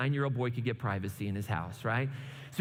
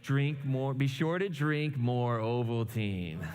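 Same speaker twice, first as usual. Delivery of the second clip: slow, lower-pitched mock reading of a message; deliberate decoding; 3 semitones lower, slower than usual. Boy reading the decoded secret message, slow and deliberate. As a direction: spell out the letdown slowly.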